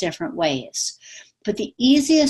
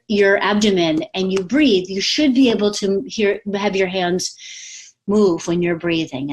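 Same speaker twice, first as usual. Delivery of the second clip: ordinary talk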